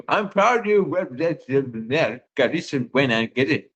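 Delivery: imitating a drunk man's garbled English; drunk gibberish. Drunk guy trying English on the missionaries, imitated gibberish. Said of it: "gibberish in English with a couple English words".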